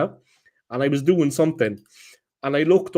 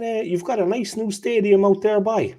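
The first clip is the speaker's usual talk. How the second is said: retold jibe in a pitched-up, lightly cheeky Irish accent; 7 semitones higher than usual. Barman teasing a Spurs fan, mock Irish accent. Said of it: "I did it in a real cheeky Irish accent".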